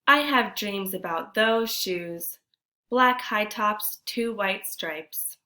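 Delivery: put-on expressive read-aloud for children; storybook narration. A narrator's reading. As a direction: tell the story to the class with feeling. Reads picture book aloud.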